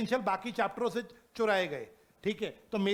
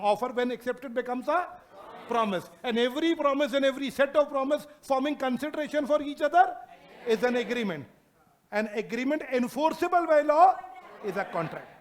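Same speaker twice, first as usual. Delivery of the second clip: drawn-out word-by-word reading; slow dictation; 2 semitones higher, 3 dB louder, much slower than usual. Shown as a demonstration. Teacher dictating the acceptance definition, very slow. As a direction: read it slowly so they can write. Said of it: "dictating definition to class".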